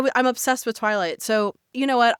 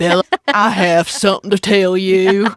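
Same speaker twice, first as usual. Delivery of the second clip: brooding Southern vampire voice; Bill Compton; 6 dB louder than usual. Acted. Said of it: "You know who that sounds like? It sounds like Bill Compton".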